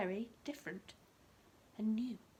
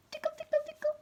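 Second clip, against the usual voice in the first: much higher, squealy tickle chant; playful tickling; 8 dB louder, faster than usual. Acted. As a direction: tickle the words out like tickling fingers.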